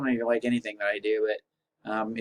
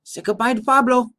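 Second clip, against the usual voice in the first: put-on loud, high Spanish accent; cheerful Spanish accent farewell; 9 semitones higher, 10 dB louder than usual. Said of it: "Can't do the Spanish accent the whole time".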